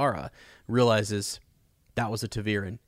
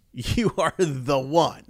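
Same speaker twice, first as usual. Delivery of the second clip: mock prophetic voice; 4 semitones higher, much slower than usual. Voicing the prophecy to Rand.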